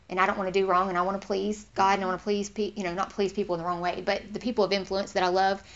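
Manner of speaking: ordinary delivery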